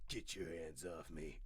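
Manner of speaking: low, slow gruff Rapunzel voice; Rapunzel as a dude. Acted. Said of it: "here's Rapunzel from".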